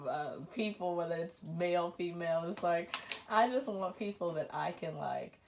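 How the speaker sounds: ordinary talk, nothing marked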